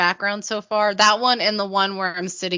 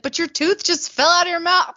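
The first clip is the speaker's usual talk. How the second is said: incredulous friend; 9 semitones higher, 4 dB louder than usual. Retold or imitated. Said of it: "my friend was like".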